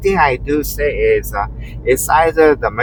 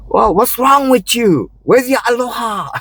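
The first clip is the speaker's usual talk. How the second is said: mock indignant man; 12 semitones higher than usual. Man scolding me at the booth, high indignant mock voice. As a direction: act out his outrage, hammed-up. Retold confrontation, quoting the man.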